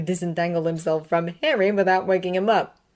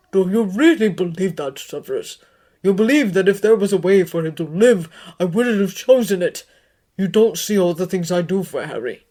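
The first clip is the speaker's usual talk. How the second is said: put-on older, firm, louder rebuke; stern headmaster; 8 dB louder than usual. A narrator's reading. Dumbledore answering Severus's accusation, stern. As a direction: answer with hurt gravity.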